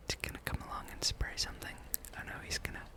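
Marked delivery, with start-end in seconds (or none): none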